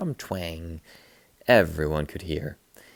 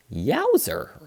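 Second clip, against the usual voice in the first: excited; 8 semitones higher, 4 dB louder than usual. A narrator's reading. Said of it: "Ralph said".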